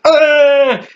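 high-pitched frantic "ugh"; hammed-up wail of frustration; mock frantic wail. Mom freaking out at her child, high mock wail.

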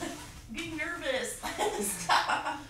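high nervous giggling pleas; nervous laughing. Real, not acted. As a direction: giggle and beg them to stop. Strapped in bed, nervous laughing.

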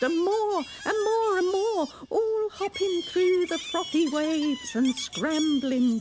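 loud, high, rising each time; building excitement. A narrator's reading. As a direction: pile them on eagerly. Building list.

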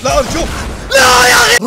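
high, loud crying plea; desperate cry. Real, not acted. Gamer about to be killed, crying plea. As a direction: wail as you're about to lose.

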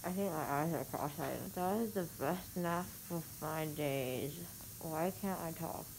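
numb tongue slurring; thick, numb-mouthed speech; numbing throat spray. Real, not acted. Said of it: "my tongue is not in, I can't talk".

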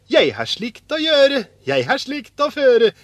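high, loud, singsong retort; eager insistence. A narrator's reading. Askeladden tells his brothers he will keep it, insistent. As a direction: stand up cheerfully for your find.

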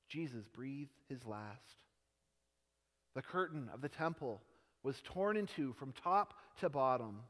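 normal talking voice

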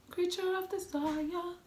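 slow high sultry purr. Pretend. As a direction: breathe it out like a seductress. Dramatic playful intro.

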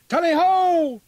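high, loud huntsman's cry; ringing tally-ho across the river; hunting halloo. Acted. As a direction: throw the call out to the whole field. Old man's hunting call.

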